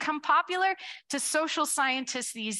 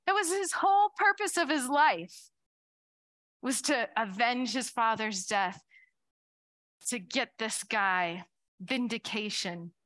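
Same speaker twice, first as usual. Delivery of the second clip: normal talking voice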